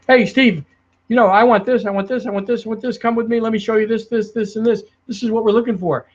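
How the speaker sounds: rapid-fire client. Retold or imitated. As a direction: rattle it off like an impatient client who wants it all now. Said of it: "So if you got somebody talking quick".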